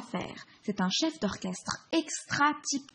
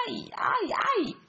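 yelps of pain; 12 semitones higher than usual. Acted. Monkey pulls his hairs.

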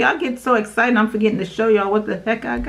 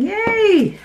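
cheer; 12 semitones higher than usual. Real, not acted. Last item cheer.

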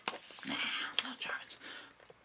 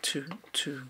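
whispering; 3 semitones higher, 5 dB quieter than usual. Acted. ASMR roleplay whispering.

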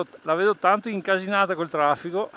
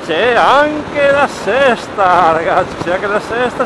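excited; 5 semitones higher, 9 dB louder, slower than usual. Real, not acted. Finding sixth gear while riding.

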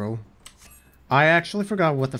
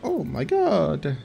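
shocked; 5 semitones higher, slower than usual. Real, not acted. Gear vanishes on equip.

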